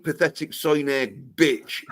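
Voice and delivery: ordinary delivery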